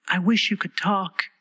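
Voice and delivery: put-on high, slow girl's voice; a dreamy little girl sighing; wistful young girl. A narrator's reading. Alice's voice.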